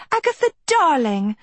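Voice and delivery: high, effusive darling voice; gushing greeting. A narrator's reading. Sophie suddenly notices Agatha, gushing. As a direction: greet her grandly, pitch swooping up.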